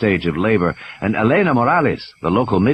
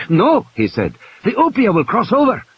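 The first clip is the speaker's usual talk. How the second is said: frightened man warns her off; fierce protest; 12 semitones higher than usual. A narrator's reading. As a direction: stop her with fear and force. Manuel pulls her back fiercely.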